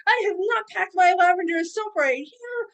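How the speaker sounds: high, loud, theatrical proclamation; hammed-up courtly vendor voice; grand merchant reply. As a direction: call it out grandly with laughter in it. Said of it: "And I'm like".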